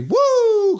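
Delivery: very high, loud, held woo; piercing cheer of joy; celebratory whoop. Real, not acted. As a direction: whoop for the goal at full voice. Celebrating the goal.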